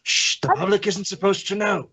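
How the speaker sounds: ordinary talk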